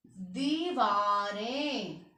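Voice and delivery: दीवारें stretched slowly, nasal ें clear at the end; slow nasal plural. Shown as a demonstration. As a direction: sound out दीवारें for the class. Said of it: "बिंदी।". (दीवारें धीरे बोलकर बिंदी दिखाना)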